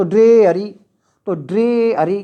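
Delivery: "ड्रे" strong and long, "अरी" light; stress on first syllable. Shown as a demonstration. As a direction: hit "ड्रे", then let "अरी" fall away. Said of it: "पहला stress, दूसरा unstress". ("ड्रे" पर ज़ोर, "अरी" हल्का)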